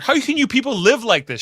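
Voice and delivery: mock exasperation at the doctor; exasperated. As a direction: demand it in disbelief. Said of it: "she says to Doctor Crusher".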